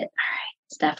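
hushed self-talk whisper; quiet inner voice checking the body. Shown as a demonstration. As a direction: whisper the question to yourself.

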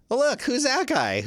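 high, playful "who's that?"; mock surprise. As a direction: squeak it out in fake surprise. Spots his own photo on screen.

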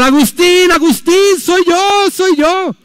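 high, loud insistent calling; woman shouting after him. Retold or imitated. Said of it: "y le empezó a gritar". (mujer gritándole a Agustín, aguda e insistente)

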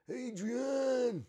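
high, loud, drawn-out mock yell; mock cry. Host compulsively repeating a colleague's name, mock yell. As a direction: bellow the name high, for fun.

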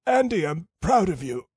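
faint, croaking dying father; weak croak. A narrator's reading. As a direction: croak it out weakly. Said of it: "in a very weak voice, croaked".

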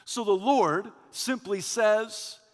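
ordinary talk, nothing marked

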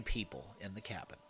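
a chilling whispered reveal. Acted. Narrator revealing the extra body in the cabin, whispered. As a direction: drop to a breathless whisper.

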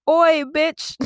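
high, loud mock British holler; hammed-up husband calling his wife. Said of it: "He was like".

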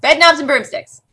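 water held in mouth. Real, not acted. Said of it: "he's got a mouthful of water".

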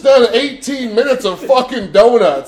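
loud, high, laughing taunt. Pretend. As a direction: yell it at the neighbors, cracking up. Mock taunt to the neighbors.

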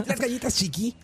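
high, mock commercial announcer; international ad announcer. Announcer advertising cookies in neutral Spanish, mock ad voice. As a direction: sell it like a dubbed radio spot. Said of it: "Un locutor internacional".

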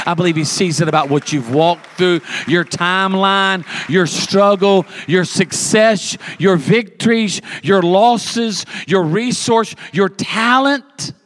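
full-force preaching crescendo; preaching climax. Acted. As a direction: build the list like a preacher lifting the room. Sermon climax builds.

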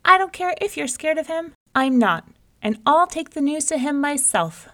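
high, loud bragging exclamation; bold boast. Acted. Rabbit boasting loudly to the scared folks. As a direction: puff up and crow it out.

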